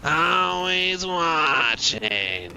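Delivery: very slow rasping smoker voice; Roz. Retold or imitated. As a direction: drag every word out like a chain smoker. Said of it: "my smoker voice sounds like, um, what's her name? I think it's Roz from Monsters".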